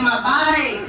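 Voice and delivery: imitated Florida vowel, "back" said as "bike"; husband's Florida accent. Husband insists to confused wife, mock Florida accent. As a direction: mimic a Florida husband repeating himself. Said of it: "y'all accent ain't that bad".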